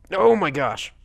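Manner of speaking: loud, high "oh" falling away; sudden exasperated outburst; startled exclamation. Real, not acted. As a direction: blurt it at the game. Reacting to game mid-story.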